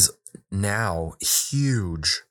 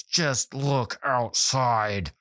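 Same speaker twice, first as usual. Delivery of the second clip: very slow, higher, strained insistence; frustrated child; 4 semitones higher, much slower than usual. Retold or imitated. Frustrated daughter urging her dad to look, imitated. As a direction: drag out each word like an exasperated kid. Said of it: "my daughter was so frustrated".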